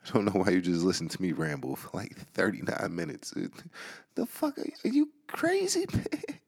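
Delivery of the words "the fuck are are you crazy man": high, squeaky mock disbelief; high-pitched teasing bafflement; incredulous squeak. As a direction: squeal at them like they've lost it. Mocking listeners for listening.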